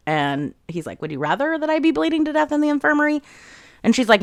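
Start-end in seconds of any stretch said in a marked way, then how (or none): 1.0-3.2 high, quick mock question; sarcastic comeback. Zayden needling Violet about being angry he is safe, sarcastic. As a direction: pitch it way up as a pointed rhetorical question.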